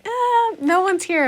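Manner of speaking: mock panic. Teen hiding from family friend, high mock dismay. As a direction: squeak it like someone trying to hide. Hiding, pretends nobody is there.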